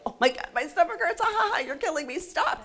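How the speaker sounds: high, quick, giggly squeal; mock can't-breathe laughing plea; acted helpless laughter. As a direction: act out laughing till your stomach hurts. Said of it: "you're laughing so hard".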